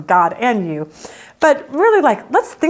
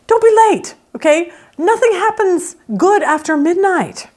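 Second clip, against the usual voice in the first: mock parent; 8 semitones higher than usual. Parent voice mocked.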